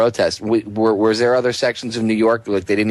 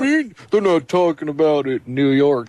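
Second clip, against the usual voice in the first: higher, mock-outraged complaining listener; indignant emailer; 6 semitones higher than usual. Host imagining a listener email scolding J J, mock outrage. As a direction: play an affronted listener pouncing on a mistake. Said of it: "somebody to be like, email you".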